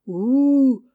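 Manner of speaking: loud, high hooting ouh; owl hoot. Acted. Owl hoots above the nest, loud hoot.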